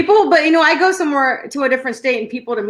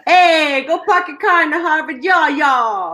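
put-on horrible Boston accent; 4 semitones higher than usual. Podcaster trying the Boston line for laughs, horrible Boston accent. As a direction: belt out a bad Boston accent with Texan creep. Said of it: "That was horrible".

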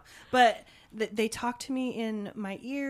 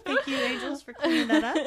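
high, laughing gratitude; laughing, high thanks to the angels; 7 semitones higher, 6 dB louder than usual. Real, not acted.

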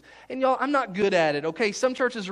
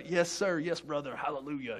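mock cool pastor talk; 4 semitones lower, 6 dB quieter than usual. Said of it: "trying to talk like, they talk so cool".

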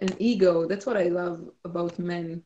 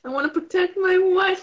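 mock tender husband; 10 semitones higher than usual. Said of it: "quoting the husband, mocking".

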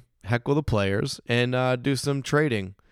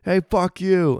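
raised, higher heckler's shout; mock heckling scream; 9 semitones higher, 7 dB louder, slower than usual. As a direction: imitate a crude fan yelling out. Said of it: "You can't be screaming out".

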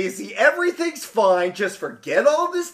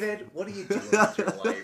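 disappointed father; 9 dB quieter, faster than usual. Retold or imitated. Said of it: "father's tone".

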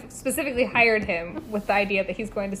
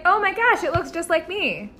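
delighted; 8 semitones higher than usual. Retold or imitated. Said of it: "she's like".

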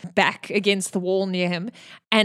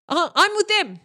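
much higher, sheepish little voice with a laugh; awkward Toriel; 12 semitones higher than usual. Acted. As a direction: squeak it out nervously. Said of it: "Toriel's like".

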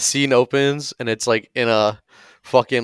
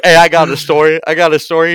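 eager loud bro; 5 semitones higher, 8 dB louder than usual. Retold or imitated. Eager dude interrupting.

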